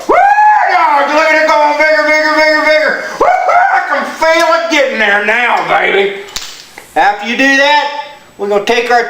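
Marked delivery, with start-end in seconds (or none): 0.1-6.3 excited whooping. Acted. Man pumping air into his tire, gleefully whooping. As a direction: cheer the tire on with glee. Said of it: "and you go".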